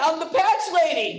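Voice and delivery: ordinary talk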